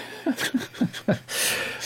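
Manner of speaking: breathy little laugh; soft laugh. Real, not acted. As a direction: chuckle under the breath. Laughs at own tech helplessness.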